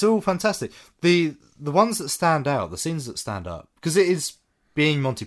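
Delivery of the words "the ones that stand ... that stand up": ordinary talk, nothing marked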